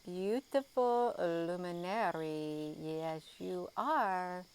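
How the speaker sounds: cooing. Real, not acted. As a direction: coo at it like a pet. Cooing to the star.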